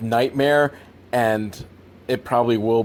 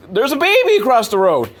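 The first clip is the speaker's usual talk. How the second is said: calling out; 10 semitones higher, 5 dB louder, faster than usual. Retold or imitated. Imagined bystander calling out.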